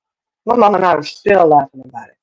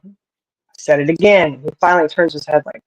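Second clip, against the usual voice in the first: ordinary talk, nothing marked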